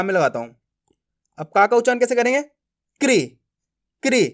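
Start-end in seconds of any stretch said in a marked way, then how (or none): 3.0-3.2 "क्री" as one very short syllable; quick pronunciation. Shown as a demonstration. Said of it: "तेजी के साथ उच्चारण करना है". 4.0-4.3 "क्री" as one very short syllable; quick pronunciation. Shown as a demonstration. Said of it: "तेजी के साथ उच्चारण करना है".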